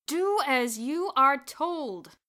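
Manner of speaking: very slow, loud furious roar; angry roar. A narrator's reading. Headmaster roars at Bruno to obey. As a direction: explode at the boy. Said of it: "Mister Sturgeon roared".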